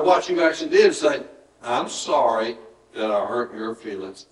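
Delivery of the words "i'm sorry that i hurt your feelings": slow, low, soft apology; simple sincere apology. Shown as a demonstration. Preacher modelling an apology left alone, slow and quiet. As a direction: say it gently and slowly, letting it end. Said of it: "and leave it alone".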